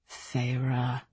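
low, hushed male-lover voice; low and tenderly devout; reverent. A narrator's reading. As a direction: say the name softly, like a prayer. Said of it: "my name like a prayer".

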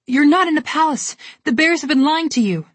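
high, loud defiant shout. A narrator's reading. Alex shouting at the Snow Queen, high and defiant. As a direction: throw the truth back at her. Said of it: "Alex shouted back at her".

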